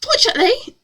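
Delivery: high-pitched hammed-up British accent; cheerful British accent. A narrator's reading. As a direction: chirp it in a badly faked British accent. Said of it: "she said cheerfully in a lovely British accent".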